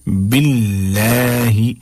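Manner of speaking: "बिल्लाही" very slow, l thin and light; thin lam. Shown as a demonstration. Teacher after giving the rule, "बिल्लाही" with thin lam. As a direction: hold a long, thin "laa" in "बिल्लाही". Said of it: "बिल्कुल बारीक करके पढ़ना है".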